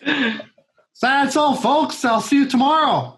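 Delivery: high, slow director impression; David Lynch. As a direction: sign off as the director, high and drawn out. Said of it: "actually a really good fucking impression".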